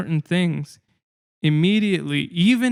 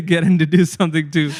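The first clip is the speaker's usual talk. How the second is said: laughing through quote; 6 dB louder than usual. Retold or imitated. Parents' imagined suspicion, laughing.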